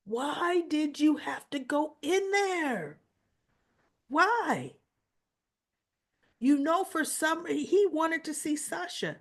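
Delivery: mild mock demanding Carly; Carly's accusing question. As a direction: press her hard. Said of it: "And Carly says".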